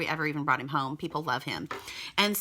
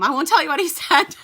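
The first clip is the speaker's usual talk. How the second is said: laughing; 10 semitones higher, 9 dB louder than usual. Real, not acted. Wife hinting at what her husband said, laughing. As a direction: break into a real laugh, higher and louder.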